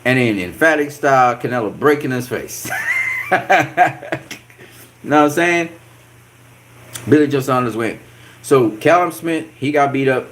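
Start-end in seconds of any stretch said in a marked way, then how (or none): 2.8-4.3 high, gleeful cackle; gleeful laugh. Real, not acted. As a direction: laugh with relish at the beating. Gloating over a broken face.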